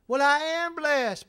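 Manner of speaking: AM pushed high and loud; mock insistence. Visitor insists when challenged.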